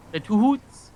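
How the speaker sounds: broad Minnesotan vowels on Two Hoots; Minnesota accent. Real, not acted. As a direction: let the Minnesota accent slip out. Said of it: "my Minnesotan really came out there".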